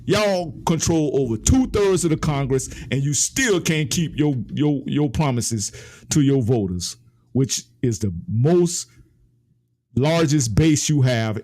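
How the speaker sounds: ordinary talk, nothing marked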